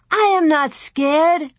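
high, slow, emphatic denial; indignant boy. A narrator's reading. Louie denies being scared, slow indignant protest. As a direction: protest each word like an insulted child.

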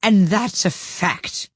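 put-on high, slow, gruff old duchess; rough, haughty duchess voice. A narrator's reading. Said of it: "said the Duchess".

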